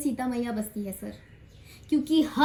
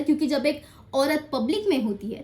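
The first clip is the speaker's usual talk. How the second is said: ordinary talk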